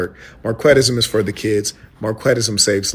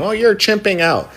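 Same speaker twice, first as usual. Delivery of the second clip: much higher, mocking squeal of a weak man; mock whiny quote; 12 semitones higher, 4 dB louder than usual. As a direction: sneer it up high like a crybaby. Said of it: "they could play that game".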